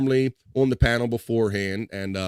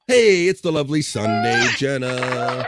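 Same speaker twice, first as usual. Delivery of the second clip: loud, high call that eases off; hyped-up greeting; 3 semitones higher, 5 dB louder than usual. Real, not acted. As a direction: hail a favourite guest as they arrive. Host hails arriving viewer.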